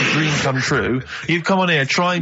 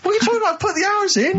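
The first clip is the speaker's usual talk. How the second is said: much higher, squeaky indignant protest; mock indignation; faster than usual. To Carl and Rick insisting he tried, a high indignant squeal. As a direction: protest like a wronged child.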